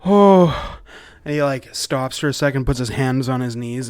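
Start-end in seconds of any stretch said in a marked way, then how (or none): 0.1-0.8 high, loud gasped fragments; wheezing, out of breath. Acted. Fleeing man to the party, badly winded, wheezing. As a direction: shout it in bursts between heaving breaths. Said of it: "and just wheezing".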